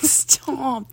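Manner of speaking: ordinary delivery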